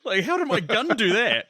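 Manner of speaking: high-pitched, baffled outburst; mock bewilderment. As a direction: imitate someone stunned by their own gun. Retelling a baffled shooter's reaction.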